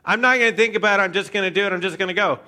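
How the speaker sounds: fast, high, loud burst; impulsive. Acted. Impulsive Peter deciding to leap from the boat, headlong rush. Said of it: "Peter is the king of the, like".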